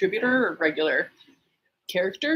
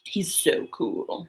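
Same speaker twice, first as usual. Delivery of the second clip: whispered; 4 dB quieter, much slower than usual. Pretend. Swooning over a crush.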